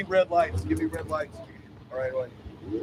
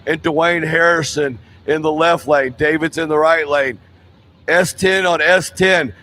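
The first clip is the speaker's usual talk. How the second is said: big drag strip announcer read; track announcer; 7 dB louder than usual. Acted. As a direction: sell the matchup to the crowd. Announces the matchup.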